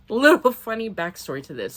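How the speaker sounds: usual speaking voice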